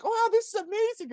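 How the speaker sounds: put-on a child gasping at tree magic; amazed kid. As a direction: squeal it like a dazzled child. Voicing an amazed Harfoot kid.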